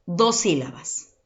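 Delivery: normal talking voice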